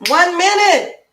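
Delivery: high, loud astonished exclaim; amazed exclaim. Real, not acted. Host marveling at covering two languages so fast. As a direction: marvel at how much got done.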